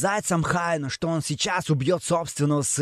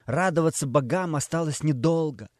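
ordinary delivery, nothing marked

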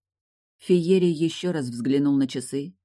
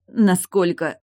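higher, louder pained question; strained, moaned query; moaning in pain; 5 semitones higher, 7 dB louder, slower than usual. A narrator's reading. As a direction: groan the question out through pain. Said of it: "Тильда простонала".

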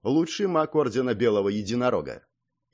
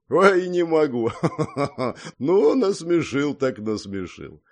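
slow, higher, acted laughing speech; rolling with laughter; 4 semitones higher, 4 dB louder, slower than usual. A narrator's reading. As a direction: gasp it out through hearty mock laughter. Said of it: "покатываясь от смеха на месте".

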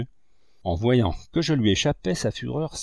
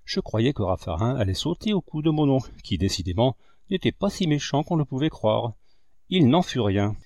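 ordinary delivery, nothing marked

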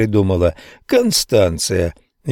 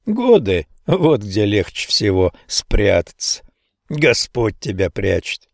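ordinary delivery, nothing marked

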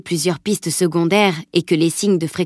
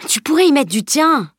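higher, mildly indignant protest; indigné; 8 semitones higher, 3 dB louder than usual. Acted. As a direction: protest like a put-out boy. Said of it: "s'indigna-t-il". (indignation légère de garçon vexé)

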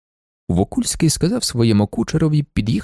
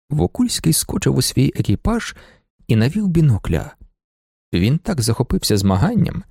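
ordinary delivery, nothing marked

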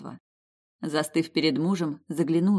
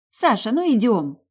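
impatient high call; 6 semitones higher, 6 dB louder than usual. Acted. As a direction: hurry them along, louder. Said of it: "Катя проявила нетерпение".

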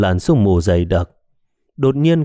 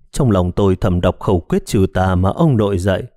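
plain reading voice

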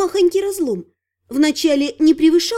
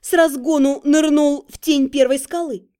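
ordinary delivery, nothing marked

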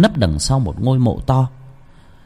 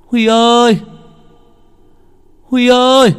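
high, loud call across the hillside; 14 semitones higher, 6 dB louder than usual. Acted. Grandma calls her missing son at the graveyard, loud high call. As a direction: call the lost boy's name into the night.

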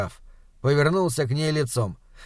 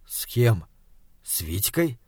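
stunned; 5 semitones lower, 4 dB quieter than usual. A narrator's reading. Stunned husband.

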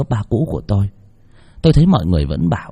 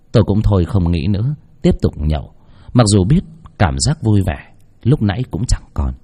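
plain reading voice

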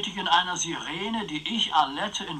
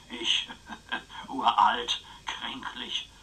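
mock frail whisper; 3 semitones lower, much slower than usual. Uncle plays the frail old man.

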